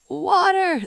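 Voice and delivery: ordinary talk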